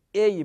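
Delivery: "ای" said as "ey"; forbidden glide reading. Shown as a demonstration. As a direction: say "ای" as e plus y, a wrong reading. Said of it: "نه".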